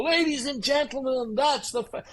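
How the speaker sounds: mock-announce your golf shot like a drunk; drunk announcer. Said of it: "goes to take his next shot and go".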